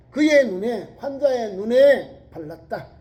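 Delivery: usual speaking voice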